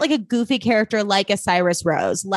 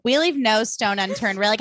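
ordinary talk, nothing marked

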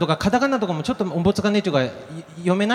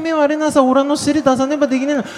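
protesting wife; 8 semitones higher than usual. Retold or imitated. Wife refusing her husband's demand, high indignant protest. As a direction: protest back in dialect, pitch climbing. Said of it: "奥さんは言うわけよ".

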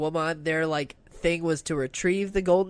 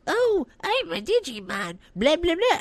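inarticulate Digimon impression; 10 semitones higher than usual. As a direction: babble like a little monster who can barely talk. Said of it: "they become more articulate".

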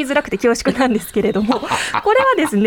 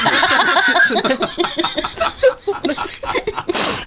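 embarrassed laugh; 5 semitones higher than usual. Real, not acted. Told she already said it.

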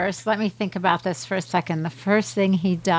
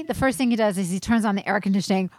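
normal talking voice

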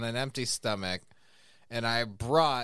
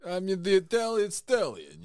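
put-on Philly boxer accent, a bit louder; Rocky impression; 4 semitones higher, 4 dB louder than usual. Still doing Rocky for the co-host, a Philly boxer accent. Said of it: "It's Sylvester Stallone".

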